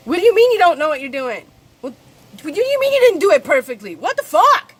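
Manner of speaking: imitating her own harsh inner critic. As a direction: berate the little girl, rising to a yell. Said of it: "I'm not gonna do any of that to her".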